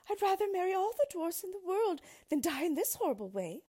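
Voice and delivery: high, loud, frantic princess; wailing, terrified plea; desperate cry. A narrator's reading. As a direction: beg for your life at the top of your range. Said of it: "cried the princess, clasping her hands".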